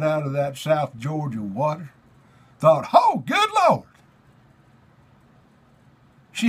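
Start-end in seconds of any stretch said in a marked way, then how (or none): none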